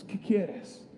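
breathless whisper. Retold or imitated. Jesus quietly asking the beggar what he wants. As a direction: ask it softly, almost a breath. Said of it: "solo le pregunta eso".

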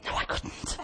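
breathy whispered self-admission; whispered aside. Retold or imitated. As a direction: whisper it to yourself, deflated. Admits own thought to herself.

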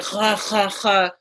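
harsh throaty KH for "Ha, ha, ha"; mock Spanish guttural H; imitated Spanish throat sound. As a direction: rasp each "Ha" at the back of the throat. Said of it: "with their strong accent".

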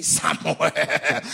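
low, croaky mock God. Preacher mocking the expected sound of God calling, croaky voice. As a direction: put on a croaking bass. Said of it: "Not croaky voice like we make to believe".

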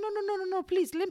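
high, loud mock plea of no; pleading refusal. Refusing the nurses in labor, high loud pleading. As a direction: beg them to stop, half acted.

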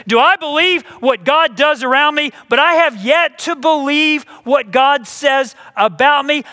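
high, loud, driving pulpit voice; preaching at full force. Acted. Pastor urging the congregation to believe, preaching loudly. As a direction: preach it hard to the whole congregation.

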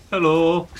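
a loud, hearty dwarven hello; dwarven welcome. Acted. Player gives the dwarf a hearty dwarven welcome. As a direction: greet like a proud dwarf. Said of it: "the traditional dwarven welcome".

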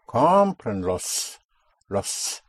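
Comprenlos, slowly, with a distinct S at the end; clear final S. Shown as a demonstration. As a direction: sound out the final S of Comprenlos and Los. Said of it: "come out with the S clearly enough".

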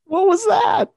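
high, loud, helpless laughter through the words; real laughing fit. Real, not acted. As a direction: squeal it out while cracking up. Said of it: "When I just laugh there".

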